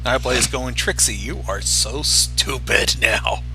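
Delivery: normal talking voice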